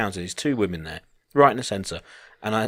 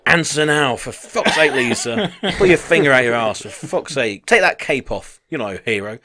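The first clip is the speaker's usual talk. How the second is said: hammed-up irritated rant, raised voice; mock outburst, half laughing; exasperated demand; 4 semitones higher, 5 dB louder than usual. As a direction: play at losing patience with someone. Acting out an annoyed questioner.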